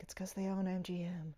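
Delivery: hushed side comment to the audience; whisper. Retold or imitated. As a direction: whisper it like a secret aside. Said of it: "I almost wanna be like".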